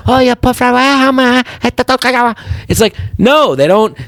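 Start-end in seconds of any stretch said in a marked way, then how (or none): 0.1-2.3 put-on high, caricatured Chinese accent; mock Chinese accent. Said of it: "all Chinese people talk like this".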